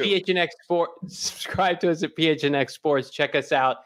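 high, pumped-up promo read; hyped announcer voice. Pretend. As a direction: sell it like a radio host. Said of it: "you're reading off a script".

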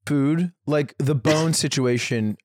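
ordinary talk, nothing marked

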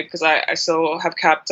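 ordinary talk

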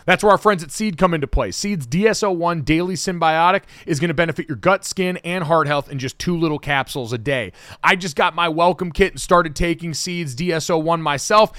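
upbeat, punchy sponsor read; podcast host's energetic promo delivery. Acted. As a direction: sell it briskly and clearly, with emphatic lift.

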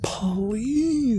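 high squeaky plea; pleading squeak. Real, not acted. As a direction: beg in a tiny high voice. Begging co-host, squeaky high.

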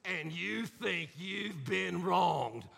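ordinary talk, nothing marked

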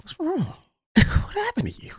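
high, light concerned voice with a laugh; mock the teacher, pitch way up; teacher's concern. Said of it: "She's like".

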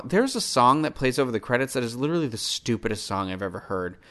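normal talking voice

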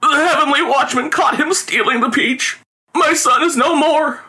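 high, slow wailing grief; anguished, drawn-out father's lament; bitterly weeping. A narrator's reading. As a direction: sob it out at the top of your pitch. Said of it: "bitterly weeping and holding the head".

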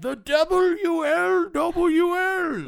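very high, very loud, long-held screech; overbearing mother. Acted. Society-proud mother announcing her ladies' league, piercing falsetto shriek. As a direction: shriek the initials slowly in falsetto.

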